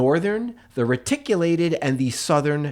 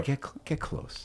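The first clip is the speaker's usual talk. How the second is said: whispered, much softer come-on; mock seductive whisper; 11 dB quieter than usual. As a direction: breathe it like a tease. Coaching Pete to be romantic.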